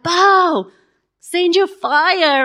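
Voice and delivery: high, loud, slow cry to Baal; screaming. Retold or imitated. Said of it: "yelling and screaming so much louder".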